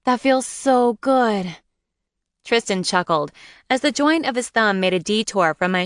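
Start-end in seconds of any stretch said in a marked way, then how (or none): 0.0-1.6 slow, high, pleasured sigh; moaning with pleasure. Acted. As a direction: melt into the massage. Moaning during massage.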